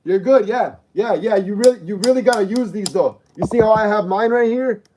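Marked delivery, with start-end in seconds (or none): none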